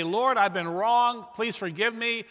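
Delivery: emphatic mock prayer. Mocks shallow confession.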